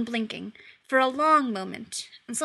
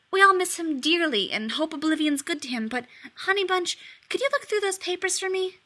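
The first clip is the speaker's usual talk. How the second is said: high, honeyed wheedling; butter her up with pet names; oily cajoling demon; syrupy sweet-talk; 6 semitones higher than usual. Acted. Demon sweet-talking daughter on phone.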